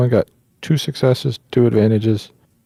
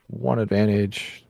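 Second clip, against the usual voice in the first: ordinary talk, nothing marked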